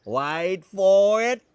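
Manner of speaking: crocodile hunter catchphrase. Retold or imitated. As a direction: draw it out and punch it, playing the croc man. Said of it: "The crocodile hunter".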